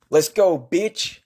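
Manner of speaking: slow, high, taunting call to fight; fight challenge. Acted. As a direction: draw it out like a ring announcer's dare. Calling out Krampus to fight.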